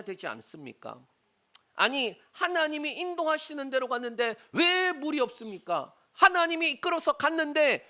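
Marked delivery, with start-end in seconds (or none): 1.7-7.8 raised, higher voice arguing back; indignant protest. Acted. Preacher as Moses confronting God over no water, indignant protest. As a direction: challenge God with an aggrieved why. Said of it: "하나님 앞에 그렇게 따질 법도".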